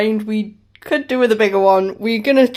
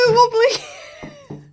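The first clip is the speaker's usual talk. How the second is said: excited squeal. Real, not acted. Cheering the saw wobbling.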